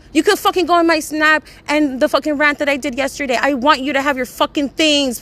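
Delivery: loud, high, furious ranting at a friend; furious rant at the best friend over her stuff; angry rant. Real, not acted. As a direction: yell it out, fed up and exasperated.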